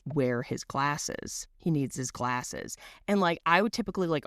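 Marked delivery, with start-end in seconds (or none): 0.0-2.8 mild mock panic. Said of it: "she immediately starts freaking out".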